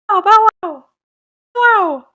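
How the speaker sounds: high, loud little yaps; playful mock barks; dog bark. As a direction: yap like a dachshund. Playing the dog.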